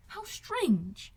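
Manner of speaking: high astonished cry; cried out. Acted. Elizabeth crying out at Wickham's story, high and astonished. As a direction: exclaim in disbelief. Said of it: "cried Elizabeth".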